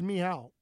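"Meow" said as ni hao, falling; mock Chinese hello "Meow"; Chinese greeting. Shown as a demonstration. Co-host asked to greet China, says "Meow" as ni hao. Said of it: "the only thing I know how to say in Chinese is".

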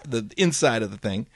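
ordinary talk, nothing marked

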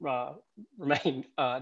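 ordinary delivery

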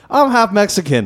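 high-pitched mock protest; retold protest. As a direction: protest indignantly, imitating her. Retold quarrel, brunette protests.

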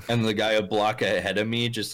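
ordinary delivery

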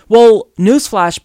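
slow, loud "newsflash"; loud taunt. Real, not acted. Taunting Trump with a loud, slow newsflash. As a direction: bellow "newsflash" slowly, mocking him.

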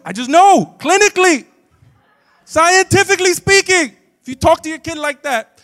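high, shouted expert correction; insistent clinical pronouncement at full volume; loud know-it-all. Acted. Acts out correcting parents.

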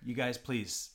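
normal talking voice

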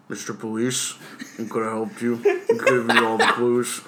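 creepy villain voice. Pretend. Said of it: "he just goes".

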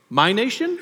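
mock surprise. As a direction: ask it as if shocked at the idea. Preacher voicing Jesus' rhetorical question.

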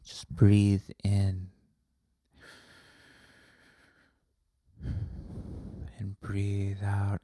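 very slow, drawn-out breathing guidance; meditation leader. Acted. Yogi leads a retreat class, slow mock-calming voice. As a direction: stretch the words with long breaths, imitating a yogi.

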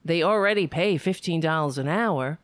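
normal talking voice

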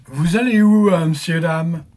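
voix de chauffeur momie, plus aiguë et forte; voix caverneuse. Acted. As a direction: lance la question comme un vieux cocher fantôme. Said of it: "Sa voix est un peu caverneuse". (voix de cocher momie caverneuse)

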